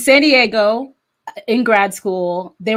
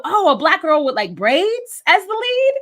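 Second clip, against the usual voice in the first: amazed; 8 semitones higher than usual. Retold or imitated. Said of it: "it made me go".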